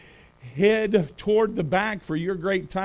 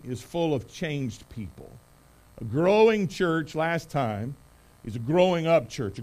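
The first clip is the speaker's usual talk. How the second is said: ordinary talk, nothing marked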